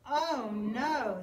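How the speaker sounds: ordinary delivery, nothing marked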